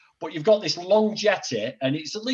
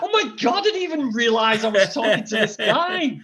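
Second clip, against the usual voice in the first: high, louder, laughing astonishment; retold shock, pitched way up through laughter; amazed disbelief; 7 semitones higher, 4 dB louder than usual. Telling friends he never realised who he'd met, high laughing disbelief. Recounting shocked discovery of famous actor.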